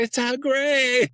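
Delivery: high, slow, stunned cry of recognition; shocked gasp. Retold or imitated. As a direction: blurt it out as if you can't believe your eyes. Shocked upon spotting the alien.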